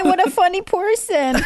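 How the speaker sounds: high, sarcastic praise; mock praise. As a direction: tease them as funny. Teasing after pun.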